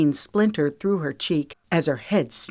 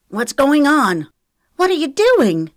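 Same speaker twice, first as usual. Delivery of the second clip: high, loud startled questioning; alarmed shock; 10 semitones higher, 8 dB louder than usual. A narrator's reading. Girls walk in on the attack, alarmed and loud. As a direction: demand answers in shock.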